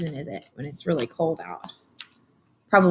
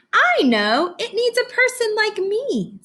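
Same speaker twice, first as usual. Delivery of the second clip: lightly voiced eager little girl, pitched up; Laurel's voice; 14 semitones higher, slower than usual. Teacher voicing Laurel for her class, lightly raised girl's voice. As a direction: chirp it like a proud kid. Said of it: "Laurel said".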